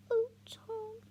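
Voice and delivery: high, loud wailing sob; crying. Acted. Mum sobs as her son talks rebellion. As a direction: cry his name out in tears. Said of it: "She's crying now".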